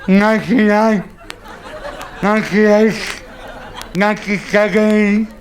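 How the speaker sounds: smothered anaesthetic countdown; muffled under mask. Acted. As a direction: count down with mouth covered. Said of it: "puts the mask over your face".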